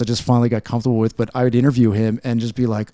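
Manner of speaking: ordinary talk, nothing marked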